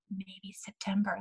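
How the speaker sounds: slow, whispered, barely committing; timid whisper. Retold or imitated. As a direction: whisper it as if afraid to say it. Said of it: "so tiny in a faint pen".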